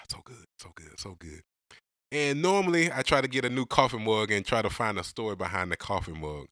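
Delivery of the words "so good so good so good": soft sighing so good after a sip; savoring a sip. Real, not acted. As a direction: breathe it out, relishing the coffee. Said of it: "let me take a sip right now".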